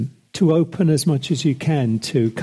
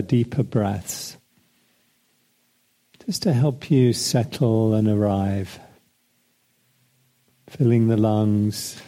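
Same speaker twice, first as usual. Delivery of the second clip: unhurried meditation voice with long pauses; guided meditation. Acted. Leads a breathing meditation.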